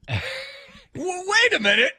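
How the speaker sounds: put-on teen realizing the plan backfired; squealed protest. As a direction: squeak out a stunned objection. Shocked reaction in story.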